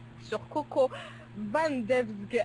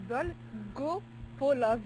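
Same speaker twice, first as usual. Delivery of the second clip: each syllable of "Dolgopolov" spelled out very slowly and evenly; slow careful repeat; much slower than usual. Shown as a demonstration. Repeating the name for the puzzled co-host, very slowly. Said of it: "Hein, comment?".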